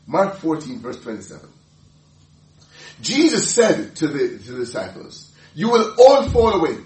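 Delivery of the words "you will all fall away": emphatic quote. Acted. Quoting Jesus in sermon.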